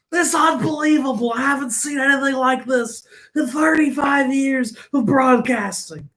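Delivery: high, loud, shouted baseball announcer; Will Ferrell-style announcer impression; Harry Carey. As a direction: call the home run like an old-time broadcaster. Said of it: "I can do Harry Carey".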